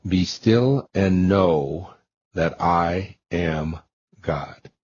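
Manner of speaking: each word drawn out slowly and reverently; slow, weighty scripture reading; slow solemn quote. Acted. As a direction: let the verse hang, very slow.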